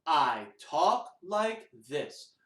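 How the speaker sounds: slow, low spoken words, spaced apart; talking voice. Shown as a demonstration. Teacher demonstrating talking voice to children, slow and spoken. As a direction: say it deliberately, word by word. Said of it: "do the talking".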